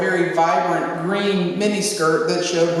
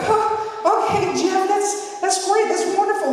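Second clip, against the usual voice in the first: much higher, overly bright soothing; forced cheerful placating; 11 semitones higher than usual. Retold or imitated. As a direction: humor him sweetly to calm him down. Calming a loud stranger.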